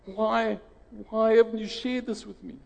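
high, loud, indignant disbelief; angry outburst. Retold or imitated. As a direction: demand it, shocked and hurt. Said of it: "he was properly upset".

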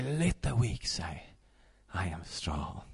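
soft, low and hushed; hushed recitation; quiet declaration. Shown as a demonstration. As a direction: murmur it like a private prayer. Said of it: "and say".

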